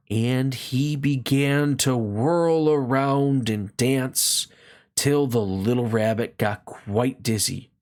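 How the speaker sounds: ordinary delivery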